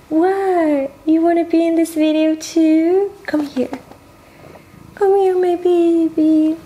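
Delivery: high, bright cooing pet voice. Real, not acted. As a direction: coax the cat over sweetly. Calling the cat over.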